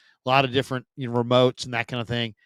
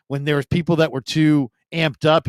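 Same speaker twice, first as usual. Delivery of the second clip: normal talking voice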